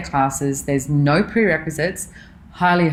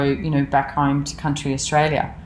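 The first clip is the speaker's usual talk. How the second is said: normal talking voice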